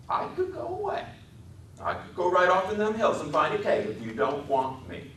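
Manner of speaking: put-on very high coaxing man-child; eager Lenny voice. A narrator's reading. Lenny threatening to leave.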